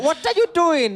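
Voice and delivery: high-pitched imitation of a Korean accent; mock Korean accent. Analyst correcting his co-host with a high mock Korean accent. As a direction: redo the line in a playful Korean accent. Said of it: "that was actually Korean accent".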